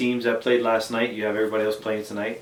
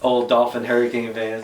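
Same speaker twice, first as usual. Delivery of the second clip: ordinary delivery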